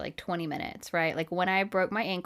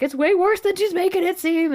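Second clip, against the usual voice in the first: high, loud urgent husband voice; 11 semitones higher, 7 dB louder than usual. Retold or imitated. Husband warning the ER staff, urgent and raised. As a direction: push it, worried for her. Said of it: "And he's like".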